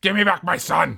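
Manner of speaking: loud, high-pitched hammed-up demand; mock demanding shout. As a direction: play a desperate parent yelling for a child. Hammed-up father demanding his son.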